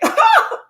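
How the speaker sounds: high loud burst of mocking laughter. Friends laughing at the one who cries, loud high mocking laugh. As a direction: laugh right at them.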